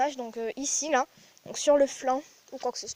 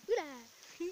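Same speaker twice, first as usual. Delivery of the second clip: ordinary talk, nothing marked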